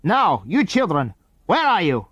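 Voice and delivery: put-on a man calls loudly for hidden children; called out loudly. A narrator's reading. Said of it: "called out loudly".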